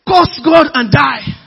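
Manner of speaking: loud, high and drawn-out, hammered word by word; bitter outburst. Acted. Job's wife tells him to give up, loud bitter outburst.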